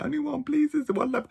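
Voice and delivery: small, high polite little voice; high, meek-sounding ticket request; high-pitched self-quote. Retold or imitated. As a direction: quote yourself high and a bit softly. Quoting self buying last ticket.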